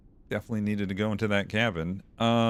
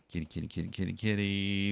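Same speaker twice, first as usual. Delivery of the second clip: cooing to a cat; 4 dB quieter, faster than usual. Real, not acted. Calling the game cats.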